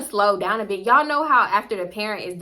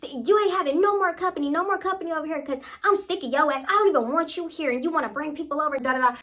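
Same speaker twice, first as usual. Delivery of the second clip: higher-pitched acted rant, ordinary volume; mom going off; 6 semitones higher than usual. Mom banning company at daughter, going off. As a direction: imitate a fed-up mother's tirade. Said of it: "her mom was going off".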